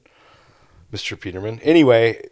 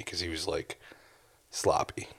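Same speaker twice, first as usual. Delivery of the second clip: whispered aside; 3 semitones lower, 11 dB quieter than usual. Retold or imitated. Drunk guy described in bar.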